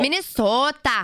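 put-on Minnesota accent. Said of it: "boots and boots. Don't you know?".

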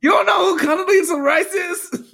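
high, loud disbelieving shout; shouting in disbelief. Real, not acted. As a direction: yell it out, can't believe it. Incredulous outburst at partner.

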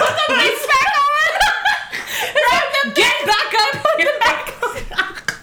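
high, loud, laughing yell; mock shouted orders. Ordering her panties back up, high mock shout. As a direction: shout commands through laughter.

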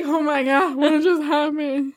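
comic put-on voice with a bit of a laugh in it; lightly hammed-up dazed cry of disbelief; Gabriel Iglesias voice. Telling a friend how she came to at the light, mock dazed. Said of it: "Why did you just sound like Gabriel Iglesias?".

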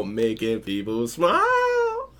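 drawn-out rising whoop on smile; exuberant. Real, not acted. Host cheering listeners on to make people smile, exuberant. Calls out the show's cheerful motto.

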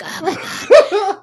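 high, loud, stretched-out squeal; mock a wasted friend's drawn-out wail; drunk friend imitation. Teasing her friend about his drunk Uber ride, squealing high.

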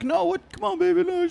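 very high mock-pleading voice; begging boyfriend. Boyfriend to girlfriend at the intercom, begging. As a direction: beg in a squeaky imitation.

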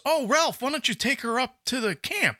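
lady's voice. Retold or imitated. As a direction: lift it up high, a sweet old lady. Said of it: "so the lady goes".

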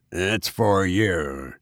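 slow, sly and malicious; malicious grin. Acted. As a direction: savour it with a nasty grin. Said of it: "with a malicious grin".

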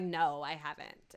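low, slow, curt denial; rude. Retold or imitated. Rude man brushing off a store owner's question, rude and low. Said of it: "he's just really rude to him".

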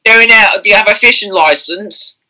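high, loud comic British policeman; British copper. Acted. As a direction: question a suspect as a hammed-up bobby. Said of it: "You've become a cobber".

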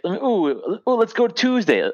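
high, slow Philly Delco imitation; Philly Delco accent. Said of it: "the Philly Delco accent".

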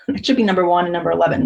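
ordinary talk, nothing marked

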